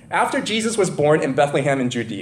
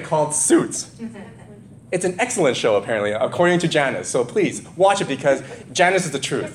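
ordinary talk

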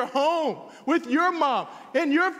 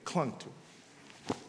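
hushed whisper; 13 semitones lower, 15 dB quieter, much slower than usual. A narrator's reading. Preacher telling how Ruth clung, in a whisper. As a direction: breathe it out in a whisper, slowly.

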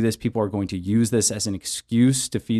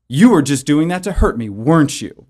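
a louder, higher mock accusation; accusing leading question; 3 semitones higher, 4 dB louder than usual. To a spouse, mock accusing leading question. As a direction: put the blame in before asking. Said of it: "presuming in that tone".

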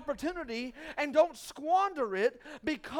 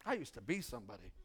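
mock lament; 13 semitones lower, 12 dB quieter, faster than usual. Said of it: "and you're like".

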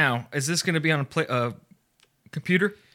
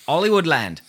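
Australian accent; 5 semitones higher, 5 dB louder than usual. Shown as a demonstration. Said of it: "say Hollywood in Australia".

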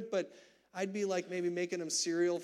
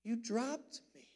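put-on child's serious question; 7 semitones higher than usual. Dad imitating his son asking gently why he was dropped. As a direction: ask it like a stunned four-year-old. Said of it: "in the form of a question".

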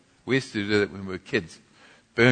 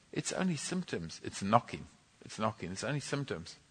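gentle reassurance; 11 dB quieter than usual. Retold or imitated. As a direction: reassure her softly. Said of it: "He says".

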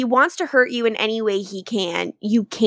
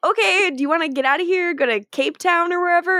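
quick, higher, offhand invite; breezy Damon; 8 semitones higher, faster than usual. Retold or imitated. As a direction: toss off the plan lightly and fast. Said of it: "And Damon's like".

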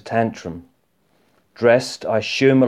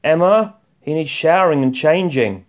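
calling out; 6 semitones higher, 4 dB louder than usual. Acted. Calling out to wife.

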